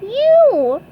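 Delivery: high, loud squeaky answer; SpongeBob voice. A narrator's reading. SpongeBob answers the Flying Dutchman, high squeak. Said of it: "said SpongeBob".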